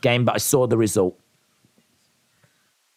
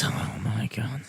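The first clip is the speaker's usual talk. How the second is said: whispered aside; 4 semitones lower, 10 dB quieter than usual. Acted. Aside during pageant bit.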